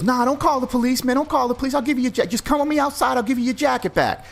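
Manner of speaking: very high, whiny pleading; pleading thug voice. Retold or imitated. Mugger begging not to call police, very high pleading. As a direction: beg him high and fast not to call.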